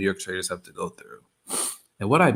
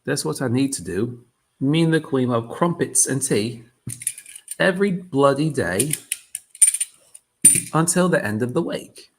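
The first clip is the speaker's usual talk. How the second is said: loud, higher, hammed-up half-London accent; mock London accent; 5 semitones higher, 6 dB louder than usual. Streamer joking he'll move to London, mock London accent. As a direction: play a cheeky Londoner wanting London, bruv. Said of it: "half London impersonation".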